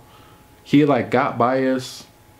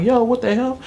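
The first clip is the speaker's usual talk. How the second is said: higher-pitched startled call; alarmed reaction; 11 semitones higher than usual. Retold or imitated. Storyteller reacting to the fight, alarmed. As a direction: retell a startled, high-pitched challenge.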